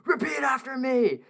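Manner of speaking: hammed-up drill-sergeant yell; imitated shout. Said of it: "and he's like".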